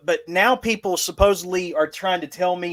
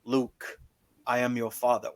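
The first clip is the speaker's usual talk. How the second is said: lower, softer movie villain line; echoing movie villain; 6 semitones lower, 8 dB quieter than usual. Retold or imitated. Friend quotes the line stuck in his head, low and hushed. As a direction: drop the voice for the famous misquote. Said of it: "echoing distinctly".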